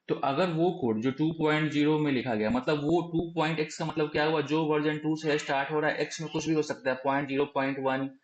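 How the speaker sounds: ordinary talk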